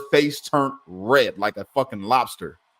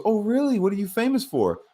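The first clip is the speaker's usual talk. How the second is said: much higher, lightly voiced curious woman; lady at the store, voiced higher and curious; mock lady voice; 10 semitones higher than usual. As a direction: imitate a sweet lady asking, pitched way up.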